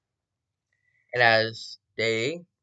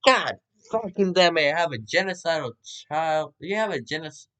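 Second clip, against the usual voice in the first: exasperated; 6 semitones higher, 3 dB louder than usual. Acted. Acted outburst of exasperated parent.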